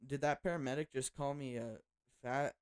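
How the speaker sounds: ordinary talk, nothing marked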